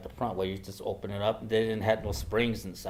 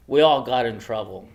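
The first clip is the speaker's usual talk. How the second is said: ordinary talk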